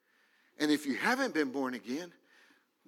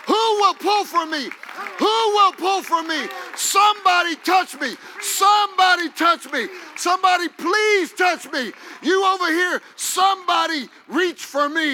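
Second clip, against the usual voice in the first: much higher, much louder desperate plea; shouted pleading as Jesus; 14 semitones higher, 11 dB louder than usual. Acted. Preacher voicing Jesus begging the crowd to reach him, loud shout. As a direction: cry out begging to be touched.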